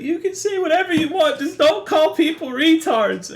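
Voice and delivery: very high, loud mock scream; imitated screaming. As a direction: mimic an upset friend yelling at the top of his range. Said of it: "And he's like".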